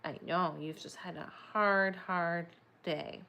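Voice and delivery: ordinary delivery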